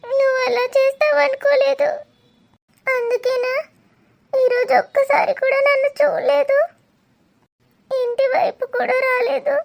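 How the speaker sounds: crying, breaking voice, pleading with an absent lover; tearful lament. Acted. Abandoned woman begs her lover Dheeru not to leave, crying. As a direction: let the sobs break through the words.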